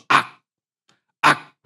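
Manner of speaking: short shouted aak cries; demonstrated scream; mock scream. Shown as a demonstration. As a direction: bark out two sharp yells. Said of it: "악을 쓴다고 말하죠".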